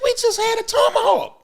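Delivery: high-pitched incredulous no; mock exasperated refusal. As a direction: squeak out the refusal in a rush. Refusing dessert to friend.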